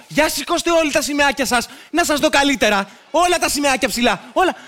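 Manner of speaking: ordinary talk, nothing marked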